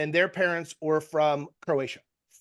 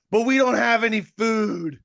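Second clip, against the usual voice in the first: put-on a light teenage whine, a bit higher and louder; whiny teen; 4 semitones higher, 6 dB louder than usual. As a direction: play the bored kid complaining, lightly. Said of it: "And they go".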